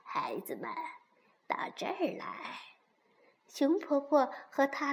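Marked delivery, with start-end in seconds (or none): 0.0-2.6 very soft, breathy elder; old granny bear. A narrator's reading. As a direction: beckon the children gently. Granny bear beckons the children. (慈祥的熊婆婆轻声招呼)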